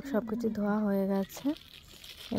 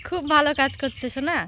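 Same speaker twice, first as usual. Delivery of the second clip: sarcastic; 5 semitones higher, 6 dB louder than usual. Real, not acted. Baby smears mess, ironic.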